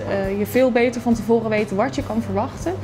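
ordinary talk

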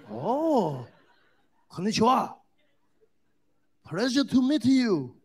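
put-on bow politely with a Japanese accent. Comic voicing the Japanese man greeting him, Japanese accent. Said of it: "He's like".

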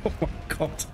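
loud laugh fading into an unvoiced oh my God; loud disbelieving laugh. Real, not acted. Player undone by a ledge, loud disbelieving laugh.

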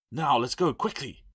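high, urgent call to move; rally them to run; urgent command. A narrator's reading. Urging escape past the caretaker.

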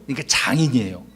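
ordinary delivery

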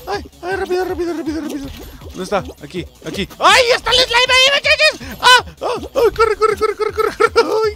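high panicked yelling; being chased. Real, not acted. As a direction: scream for your life, fleeing. El slime lo persigue. (gritos de pánico huyendo)

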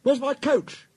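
high, loud agitated demand; flustered, high-pitched outburst; fussing. A narrator's reading. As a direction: demand it like a fussy little engine. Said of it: "Thomas came fussing".

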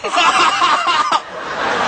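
loud, high burst of laughter. Pretend. Man claims his right to laugh back, loud laugh.

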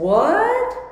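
a high, stunned "What?" rising sharply; shocked. Retold or imitated. Victim realising her attacker means to kill, shocked cry. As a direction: gasp it out in disbelief. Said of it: "She, she was shocked".

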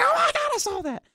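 very high laughing shriek; mock scream. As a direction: squeal like a scared friend. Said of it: "they're gonna scream out".

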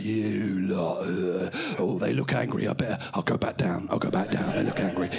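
very low, grumbling voice; deep, awkward finger voice; middle finger character. Acted. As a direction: drop right down into a surly low character. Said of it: "Your middle finger".

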